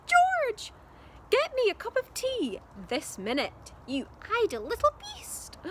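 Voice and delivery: high, loud bossy screech; grumpy grandma yelling. A narrator's reading. Grandma bossing George from the rooftop, yelling. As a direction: scold him like a cranky old granny. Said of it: "Grandma yelled".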